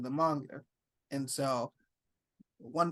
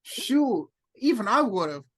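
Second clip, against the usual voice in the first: excited; 5 semitones higher, 6 dB louder than usual. Real, not acted. Friend excited about fan contest.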